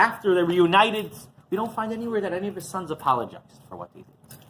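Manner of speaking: usual speaking voice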